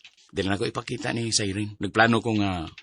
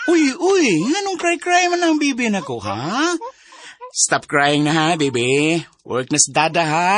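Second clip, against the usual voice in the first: much higher, louder sing-song cooing; baby talk; 6 semitones higher, 5 dB louder than usual. Acted. Father cooing to his crying baby over the phone, baby talk. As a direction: coo at the baby to calm it.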